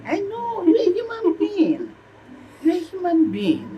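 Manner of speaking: very high-pitched, drawn-out exclamation; heightened, emotional concession; emphatic agreement. Acted. Mother eagerly agrees child was hurt.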